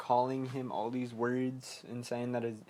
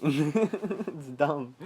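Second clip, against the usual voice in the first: usual speaking voice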